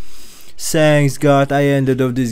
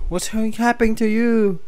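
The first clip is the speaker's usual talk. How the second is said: same question pitched way up; high character voice; 9 semitones higher than usual. Acted. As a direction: put on a high, worried character voice. Repeats line in high character voice.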